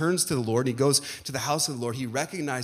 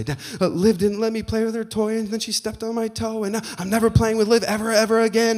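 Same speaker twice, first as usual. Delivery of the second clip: put-on higher child voice, complaining; lightly voiced child tattling on her sister; upset little daughter; 8 semitones higher, 4 dB louder than usual. Dad imitating his upset daughter, higher. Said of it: "she'll come in and she'll say".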